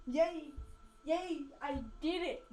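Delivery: loud happy yays; excited cheering. Real, not acted. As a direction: cheer like you just won. Kid celebrates loudly.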